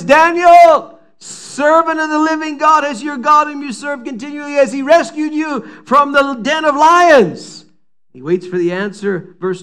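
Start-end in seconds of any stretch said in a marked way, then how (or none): 0.0-7.7 high, loud, desperate calling out; anguished call. Acted. King calls into the lions' den at dawn, anguished shout. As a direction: cry out to him, fearing the worst. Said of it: "calls out to Daniel in anguished voice".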